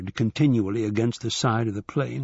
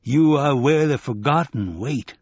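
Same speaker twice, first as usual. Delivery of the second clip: rumbling buffalo man; 3 semitones higher, 4 dB louder than usual. A narrator's reading. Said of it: "his voice was a rumble from beneath the world".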